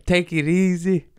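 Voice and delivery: high, pleading mock panic; panicked pleading. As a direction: beg for mercy in a high scared voice. Begging again, retold.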